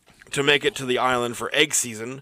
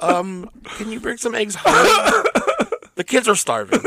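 high wife voice; 8 semitones higher than usual. Acted. Playing wife asking for eggs.

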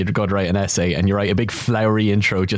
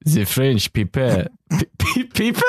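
slow, faltering mock French accent; bad French accent; 4 semitones higher, slower than usual. Shown as a demonstration. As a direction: stumble through a terrible French accent. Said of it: "I'm very bad at accents".

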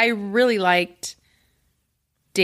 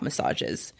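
whisper; 10 dB quieter than usual. Pretend. Whispered joke rating word.

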